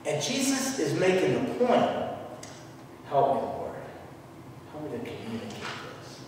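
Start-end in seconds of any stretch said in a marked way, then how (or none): 3.1-6.2 very soft, low aside; hushed real prayer under the breath; murmured prayer. Real, not acted. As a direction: drop to a private plea to God.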